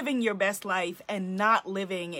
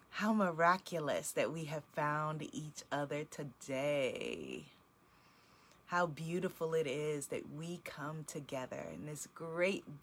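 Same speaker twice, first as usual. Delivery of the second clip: guided meditation; 3 semitones lower, 7 dB quieter than usual. Acted. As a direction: draw the circle inward, hushed and unhurried.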